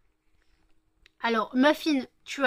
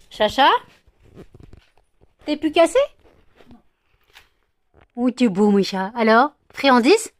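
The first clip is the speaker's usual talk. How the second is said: voix pour le chat; 3 semitones higher, 14 dB louder than usual. Real, not acted. Appelle le chat, voix pour l'animal.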